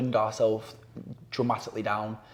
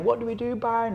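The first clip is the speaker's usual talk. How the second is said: much higher, pleading parent voice; mock crying parents; 11 semitones higher than usual. Parents begging the youth worker for help, mock desperate plea. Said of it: "the parents are crying".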